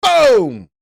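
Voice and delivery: high, explosive shout; loud exclamation. Acted. Trader punctuating his point for viewers, loud exclamation. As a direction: burst out with it like a blast.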